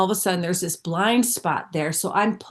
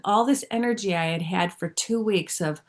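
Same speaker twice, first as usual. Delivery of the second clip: ordinary delivery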